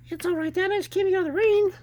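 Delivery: put-on very high child voice; young son. Boy reassuring his dad, high and innocent. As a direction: pipe up like a small boy.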